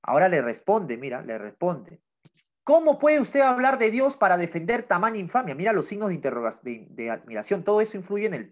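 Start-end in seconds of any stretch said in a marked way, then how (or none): 2.6-5.6 higher, louder outraged question; accusing voice raised in outrage; indignant reading. Acted. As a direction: read it as an angry reproach. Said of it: "Gritó casi con ira".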